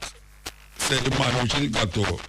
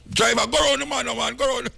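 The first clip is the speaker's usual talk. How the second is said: high-pitched frantic passenger calling out; a put-on shrill-free high mock passenger voice; mock shouting passenger; 13 semitones higher than usual. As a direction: squeal at the driver like a panicked rider. Acted taxi passenger shouting.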